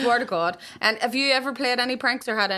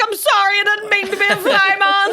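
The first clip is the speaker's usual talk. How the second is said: high, loud, laughing mock plea, much higher; mock apology; 10 semitones higher, 7 dB louder than usual. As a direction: wail an exaggerated apology through laughter. Mock apology to Main Man.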